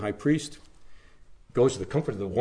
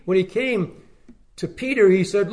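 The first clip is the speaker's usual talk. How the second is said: ordinary talk, nothing marked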